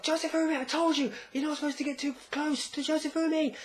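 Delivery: high-pitched, hammed-up frantic mother; mock panicked scolding. Mother to child dragged down by current, mock panicked scolding. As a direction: scold in a squeaky put-on panic.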